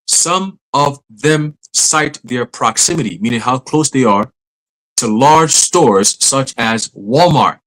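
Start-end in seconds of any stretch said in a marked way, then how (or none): 0.1-3.2 slow, deliberate, word-by-word reading. Shown as a demonstration. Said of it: "Let me read that again slow". 5.0-7.6 very slow, measured reading aloud. Shown as a demonstration. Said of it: "Let me read that again slow".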